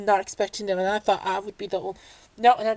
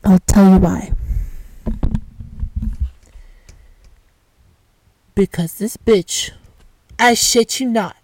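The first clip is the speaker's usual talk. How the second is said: loud; 7 dB louder than usual. Pretend. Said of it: "running gag".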